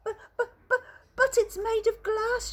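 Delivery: high stammering panic; panicky shout. Acted. Grandpa Joe protests the glass lift, high stammering panic. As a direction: splutter in alarm. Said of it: "shouted Grandpa Joe".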